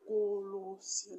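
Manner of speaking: "Colossiens" stretched slowly, syllable by syllable; sounded out slowly. Shown as a demonstration. Teacher giving an example of the last syllable, "Colossiens" slowly sounded out. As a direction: draw out "Colossiens" to expose its ending. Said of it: "la dernière syllabe qu'on entend".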